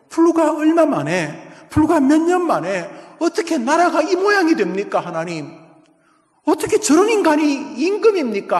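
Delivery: loud, high cries of protest to God; anguished outcry. Acted. Habakkuk crying out to God over the wicked king, anguished outcry. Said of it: "하박국이 절규합니다".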